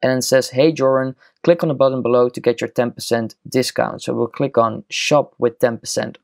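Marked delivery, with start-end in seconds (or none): none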